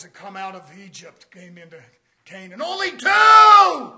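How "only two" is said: ONLY TWO roared high and slow; shouted emphasis. Shown as a demonstration. Preacher driving the point home to the church, shouting. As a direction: hammer "Only two" out at full voice. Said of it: "Only two of the elders".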